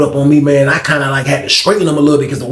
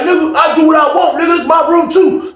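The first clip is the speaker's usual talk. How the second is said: defiant shout; 12 semitones higher than usual. Retold or imitated. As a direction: snap back, it's my room. Frank arguing back with cellmate.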